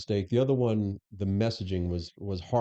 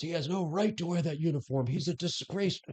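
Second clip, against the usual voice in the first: higher, mock-indignant retelling of the union head's TV attack; quoted accusation; 8 semitones higher than usual. Union head on TV condemning the new boss, mock indignant. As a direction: voice the union head's put-down in a raised pitch. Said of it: "He goes".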